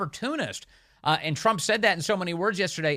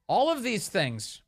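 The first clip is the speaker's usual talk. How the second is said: ordinary delivery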